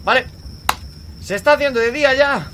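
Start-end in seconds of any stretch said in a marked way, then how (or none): 1.2-2.5 higher and louder, sudden exclamation; excited exclamation. Real, not acted. Gamer announcing that day is breaking, excited. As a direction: call it out high and loud.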